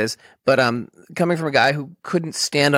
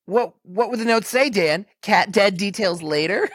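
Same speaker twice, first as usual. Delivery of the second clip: higher-pitched sarcastic comeback; mock incredulous retort; 7 semitones higher than usual. Roommate answering about the note, mock incredulous. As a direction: throw it back as an absurd question, pitched up.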